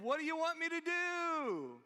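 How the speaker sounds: high, loud, pleading holler; acted long-drawn shout from below; crying out for help. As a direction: yell up for instructions, stretching the end. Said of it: "crying out for help".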